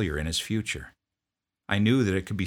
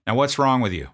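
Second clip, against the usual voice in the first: loud, higher, curt demand; drill sergeant; 6 semitones higher, 8 dB louder than usual. Retold or imitated. Drill sergeant confronting a sniffling private, loud bark.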